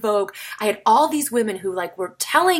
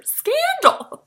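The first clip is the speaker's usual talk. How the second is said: a high, hammed-up gasp of gossip; mock scandalized; 3 dB louder than usual. Mock-shocked gossip about the teacher marrying the music teacher. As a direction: play it as fake juicy news.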